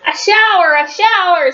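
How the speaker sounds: high, storybook mock scream; children's excited scream. A narrator's reading. Four children seeing the hose, high mock scream. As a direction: cry it out high, twice over. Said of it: "screamed Missus Ralph's four children".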